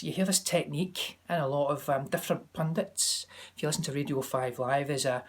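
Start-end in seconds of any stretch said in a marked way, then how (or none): none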